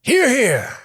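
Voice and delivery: high, loud "hear, hear" toast; rousing cheer. Acted. Warrior toasting his three comrades, loud cheer. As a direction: lift the whole room with it.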